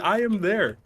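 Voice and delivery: high, proud declaration; cocky reply. Retold or imitated. Player boasting to the party, high and proud. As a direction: announce it like a done deed. Own character answers in retold game.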